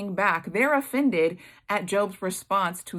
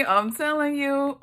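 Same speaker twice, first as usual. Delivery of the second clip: ordinary talk, nothing marked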